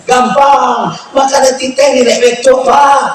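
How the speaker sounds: high, chatty wife listing signs; teasing wife explaining her hints. Retold or imitated. Husband mimicking wife explaining her signs, pitched high.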